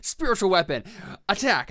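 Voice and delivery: sudden loud mock casting cry; mock battle cry. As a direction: shout it like an attack command, playfully. Acting out player's careless casting.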